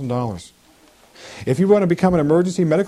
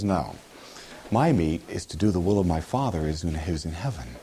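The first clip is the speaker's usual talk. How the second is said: calm Jesus; 10 semitones lower, 7 dB quieter than usual. Acted. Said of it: "Jesus says".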